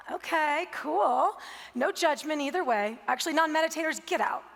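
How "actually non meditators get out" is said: mock stern. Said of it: "Just kidding".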